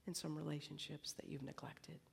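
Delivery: soft unvoiced whisper; hushed inner-voice whisper; still small voice. Shown as a demonstration. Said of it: "the voice that says".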